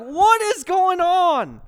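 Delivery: loud, high, drawn-out disbelief; acted amazement. Onlookers reacting to a miracle, loudly amazed. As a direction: exclaim it slowly, as if stunned.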